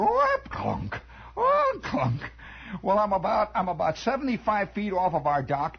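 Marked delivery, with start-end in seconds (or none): 0.0-2.2 high squeal dropping to a clunk; creaky rusty oar noises; imitated oarlocks. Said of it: "The old oar locks go".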